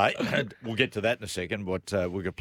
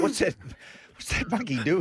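surprised; 6 semitones higher than usual. Retold or imitated. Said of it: "you're looking and go".

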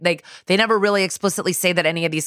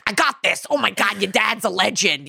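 put-on excited, higher-pitched thick accent; thick Carisi accent; 4 semitones higher than usual. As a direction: lay the accent on thick, eager and earnest. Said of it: "the accent is, like, so thick".

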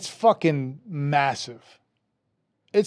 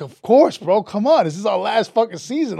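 higher-pitched insistent welcome; eager urging; 6 semitones higher than usual. Retold or imitated. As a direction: urge a friend along, pitch jumping. Retold friends eagerly welcoming him.

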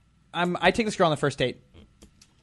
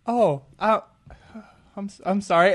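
re-enacting a flustered apology, halting and higher; terrified; 4 semitones higher, 3 dB louder than usual. Guy just turned down by the girl, terrified. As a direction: stammer out an awkward, mock-terrified retreat. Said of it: "it was the most terrified I've ever".

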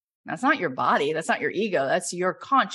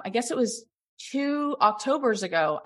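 ordinary delivery

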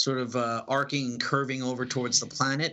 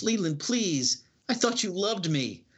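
higher, slow, wounded plea; hurt pleading; 7 semitones higher than usual. Acted. Spurned Empress pleading with Leland, slow and hurt. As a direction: plead slowly, hurt he turned on you.

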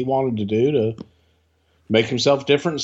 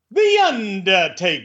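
higher, slow showy announcer; high drawn-out announcer; 5 semitones higher, slower than usual. Pretend. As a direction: stretch it out like a hype man.

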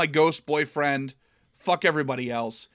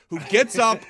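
ordinary talk, nothing marked

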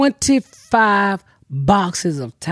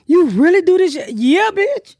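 a lightly voiced fan, raised pitch; fans' voice; 10 semitones higher than usual. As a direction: play the starstruck fan, then answer back.

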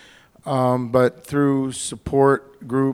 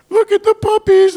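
much higher and louder, hammed-up teary squeal; mock weepy gush; 11 dB louder than usual. Husband imitating his now-emotional wife, a mock teary squeal. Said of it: "And she's crying".

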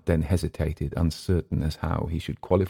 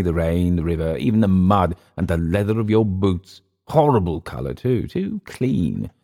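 mocking recluse; 3 semitones higher, 3 dB louder than usual. Clift jeering at rain-soaked visitors, mocking.

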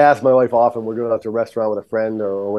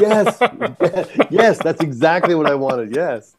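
high, laughing yes, mock-triumphant; retold delight; 5 semitones higher, much slower than usual. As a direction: act out getting exactly what you hoped for. Retold delight at wife's verdict.

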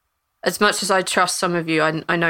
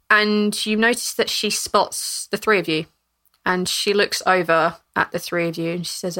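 ordinary talk, nothing marked

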